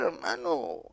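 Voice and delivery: ordinary delivery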